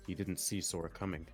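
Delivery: usual speaking voice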